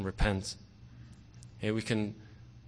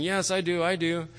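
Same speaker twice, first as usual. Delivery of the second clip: higher, keen yes; eager crowd answer; 7 semitones higher than usual. Retold or imitated. Crowd shouting yes to the tightrope walker, eager.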